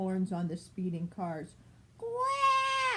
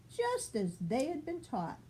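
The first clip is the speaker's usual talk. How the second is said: ordinary delivery, nothing marked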